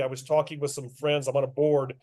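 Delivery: ordinary talk, nothing marked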